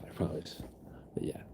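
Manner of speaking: soft whispered promise; whispering. Real, not acted. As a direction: whisper it gently.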